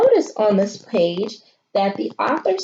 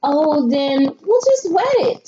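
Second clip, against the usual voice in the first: higher, bright mom suggestion; Mom's voice; 6 semitones higher, 3 dB louder than usual. A narrator's reading. Mom offering an easy fix, higher and cheerful. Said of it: "said Mom".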